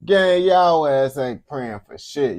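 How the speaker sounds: slow, emphatic and loud; indignant call-out. Real, not acted. Reactor calls out fake well-wishers.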